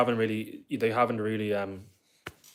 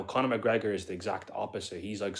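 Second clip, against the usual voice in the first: normal talking voice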